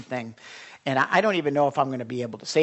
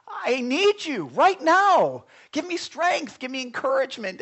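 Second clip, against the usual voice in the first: pleading prayer; 9 semitones higher, 3 dB louder than usual. Retold or imitated. Retold anguished prayer.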